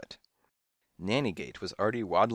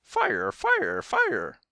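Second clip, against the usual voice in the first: dramatic shriek; 7 dB louder, much slower than usual. A narrator's reading. Parrots echo the word as an alarm, dramatic shriek. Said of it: "they shrieked dramatically".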